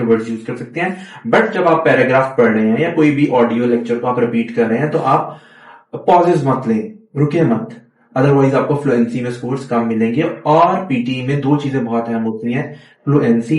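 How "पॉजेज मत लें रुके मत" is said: ordinary delivery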